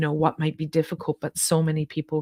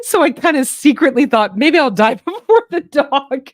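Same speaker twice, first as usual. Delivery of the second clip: laughing; 8 semitones higher, 9 dB louder than usual. Real, not acted. Confessing a secret joking thought.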